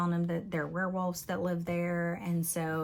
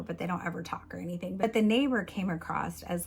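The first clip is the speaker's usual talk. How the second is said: usual speaking voice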